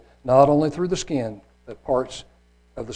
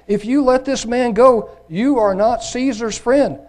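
loud, much higher, slow threatening cry; crowd crying out; 10 semitones higher, 6 dB louder than usual. Acted. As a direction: shout it at Pilate as a threat.

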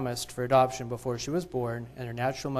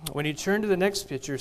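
usual speaking voice

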